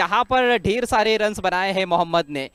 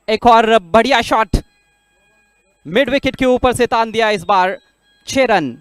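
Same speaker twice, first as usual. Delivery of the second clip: excited cricket commentary; 3 semitones higher, 6 dB louder than usual. Real, not acted. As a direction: stretch it out, building to the six. Live commentary of a six. (जोशीली cricket commentary)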